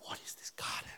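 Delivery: very soft, voiceless astonishment; breath-only acted amazement at a marvel; awed whisper. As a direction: whisper it in childlike wonder. Acts child awed by garden.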